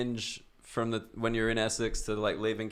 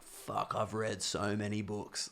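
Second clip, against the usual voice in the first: well-read snob; 2 semitones lower, 10 dB quieter, slower than usual. Acted. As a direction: play a bookish elite type, quietly and slowly. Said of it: "like, just, like".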